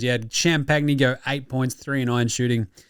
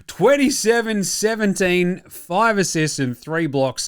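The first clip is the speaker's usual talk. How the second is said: slow announcer stat call, starting high; hyped announcer call; 5 semitones higher, slower than usual. Acted. Host hails Wemby's stat line, drawn-out announcer call. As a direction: draw out each stat for effect.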